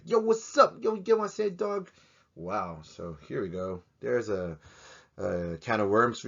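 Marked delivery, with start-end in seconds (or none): 0.0-1.9 street slang greeting. Retold or imitated. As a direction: throw out a cocky yo like a homie. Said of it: "the way they said, like".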